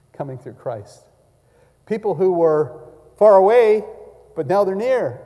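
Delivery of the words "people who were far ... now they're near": loud, high ringing proclamation; preaching at full force. Real, not acted. Preacher booming to the congregation, loud proclamation. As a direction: call it out to the whole church.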